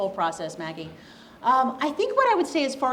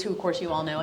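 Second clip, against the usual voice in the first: ordinary talk, nothing marked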